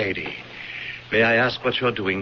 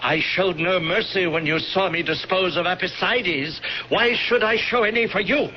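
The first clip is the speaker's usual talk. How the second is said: cruel farewell; 7 semitones higher than usual. Acted. High priest condemning Calvus to die in the vault. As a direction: savour every word of the sentence. Villain's parting curse.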